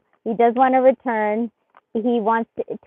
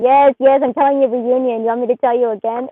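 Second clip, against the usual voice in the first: a louder, insistent voice for the cards; voicing the cards; 8 dB louder than usual. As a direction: play the impatient cards, emphatic. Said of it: "the energy saying".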